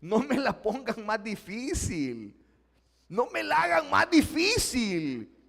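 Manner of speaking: high, gentle but firm plea; Pablo pleading back. Acted. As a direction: ask them not to make it harder. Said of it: "pero él dice".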